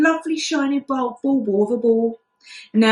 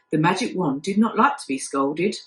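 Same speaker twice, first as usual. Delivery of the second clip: plain reading voice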